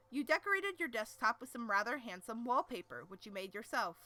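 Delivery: plain reading voice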